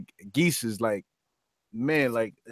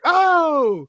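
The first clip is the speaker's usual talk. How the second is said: long, loud high "oh!"; drawn-out hype holler; crowd's amazed cry; 5 dB louder than usual. Retold or imitated. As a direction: imitate a whole crowd erupting at a big move. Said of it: "everybody was like".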